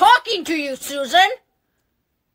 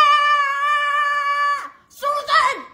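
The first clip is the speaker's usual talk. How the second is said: high panicked shouts; 10 semitones higher, much slower than usual. Acted. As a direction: yell for help while spinning. Spinning as a fan.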